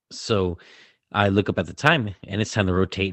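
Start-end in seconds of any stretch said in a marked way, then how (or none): none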